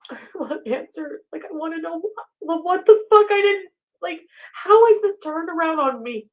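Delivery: high, strained disbelief; upset, high voice. Real, not acted. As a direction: plead for an answer, voice rising. High-pitched distressed outburst.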